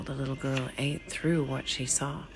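usual speaking voice

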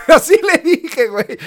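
high laughing burst. Real, not acted. Laughing to friends about talking back to the officer. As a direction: crack up at your own nerve. Laughing at his own cheek.

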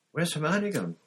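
put-on a boy looking round, wondering; Ron puzzled.

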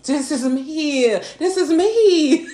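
high, slow and laughing, hammed-up delight; giddy retelling. Retelling seeing her dream man's post, giddy high and laughing. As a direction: stretch it out like a happy prayer answered. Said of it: "And I was like".

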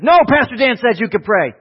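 lightly voiced kid.

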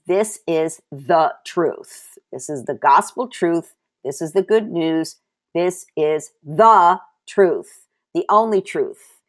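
slow, weighty repetition of "This is the truth"; preacher driving the point home; emphatic preaching. Acted. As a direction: pound the word truth each time.

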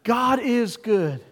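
slow, drawn-out call for a response; calling out to the church to reply; preacher's call to the congregation. Shown as a demonstration. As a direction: stretch it out and invite them to answer back. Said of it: "Let's try it again".

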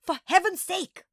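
high, loud exclamation; strained, irate outburst; exasperated. A narrator's reading. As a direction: burst out at the end of patience. Teacher exasperated by clinging child.

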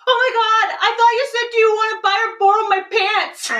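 high, loud imitation of a shrieking student; mortified exclamation. Student realizing she misheard her teacher, loud high exclamation.

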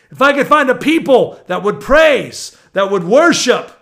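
loud, high preacher voice punching every phrase; fired-up pulpit shouting; impassioned preaching. Real, not acted. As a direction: preach at full force, voicing the enemy's boasts. Preaching at full force.

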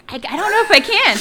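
high squawky cartoon duck voice; Donald Duck voice. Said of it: "could you do a Donald Duck voice right now".

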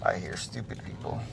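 hushed, unvoiced whisper like a spooky movie line; whispered spoof. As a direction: whisper it as a creepy secret.

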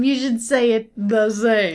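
ordinary talk, nothing marked

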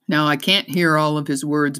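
plain reading voice

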